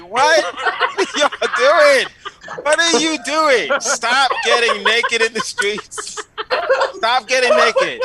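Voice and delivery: high, loud laughing disbelief. Real, not acted. Exasperated comic to audience about naked protesters, laughing loudly. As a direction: laugh out what are you doing.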